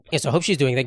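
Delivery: ordinary delivery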